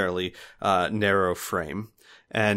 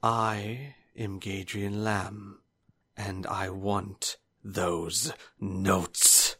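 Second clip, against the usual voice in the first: very slow, soft, deliberate; menacing villain; 4 dB quieter, much slower than usual. Acted. Gadrian Lamb tells the sister his demand with slow menace. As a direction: lay out each word like a threat.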